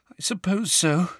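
high, subdued agreement; tearful. A narrator's reading. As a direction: agree sadly, close to tears. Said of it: "who looked tearful".

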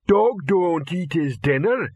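slow, dim troll speaking; Detritus the troll. Acted. Troll Detritus tells the trainers, slow and dull. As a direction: plod each word like a slow-witted troll. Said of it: "came Detritus's voice".

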